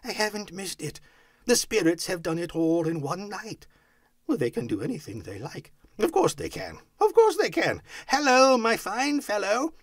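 relieved delight building to a loud hail; high, excited voice; elated Scrooge. A narrator's reading. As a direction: reassure yourself, then call out heartily. Scrooge elated, then hails boy.

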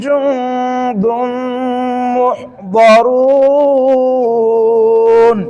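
slow drawn-out chant, words held long; tilawat recitation. Shown as a demonstration. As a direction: intone the verse slowly. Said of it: "একবারেই আমরা complete করতে পারি". (ধীর সুরে তিলাওয়াত)

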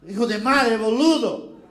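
loud Argentine Spanish swearing; mock angry Argentine; Argentine cursing. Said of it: "próprio argentino".

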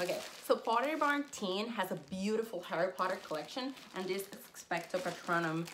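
ordinary talk

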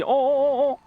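loud, high startled stammer; alarmed. Pretend. Alarmed as confrontation escalates.

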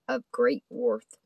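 ordinary delivery

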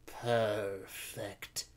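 soft, drawn-in cat-lady purr. A narrator's reading. Conductress hearing the castle is ahead, purring. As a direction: purr it with quiet satisfaction. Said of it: "purred the conductress".

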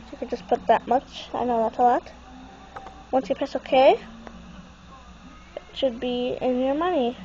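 ordinary talk

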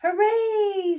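bursting with delight; squeal of joy. A narrator's reading. Bulldozer squealing with joy at getting the job. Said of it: "squealed Bulldozer".

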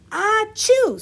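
big high sneeze; loud sneeze. Acted. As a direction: sneeze it out loudly. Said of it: "sneezed Walrus".

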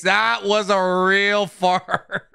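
slowed down and breaking into laughter mid-sentence; can barely get it out for laughing. Real, not acted. Streamer cracking up telling chat about the fart, laughing. Laughs through the repeated line.

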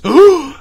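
loud, high feigned gasp; mock shocked gasp. Host on drug trafficking news, mock shocked gasp. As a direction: suck in air as if scandalized.

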